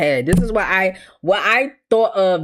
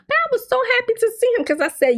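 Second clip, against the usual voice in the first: excited; much faster than usual. Real, not acted. Excited recall.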